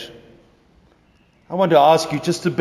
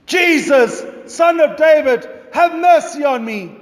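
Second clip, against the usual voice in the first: loud, high pleading cry; calling out; 13 semitones higher, 3 dB louder than usual. Acted. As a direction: beg at the top of your voice. Blind beggar calling out again.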